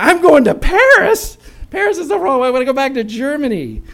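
high, loud panicked retelling of being lost. Retold or imitated. Panicked at wrong road sign.

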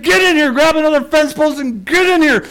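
high loud commanding shout; urgent order. Retold or imitated. Dad orders his son to grab a post, shouting. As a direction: bark the order to hurry in.

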